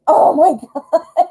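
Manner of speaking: laughing exclamation. Real, not acted. Reader stunned by card, laughing. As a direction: break into a laugh of disbelief.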